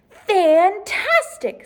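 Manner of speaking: high, slow, delighted "Fantastic"; drawn-out praise. Acted. Teacher praising a student, high and drawn out. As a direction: stretch it out with big delight.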